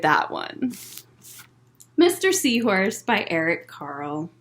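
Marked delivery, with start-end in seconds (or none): none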